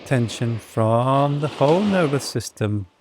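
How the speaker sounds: soft, unhurried meditation voice with long pauses; guided meditation. Real, not acted. Yoga teacher settling the class after breath work, soft meditation. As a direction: settle them into stillness, phrase by phrase.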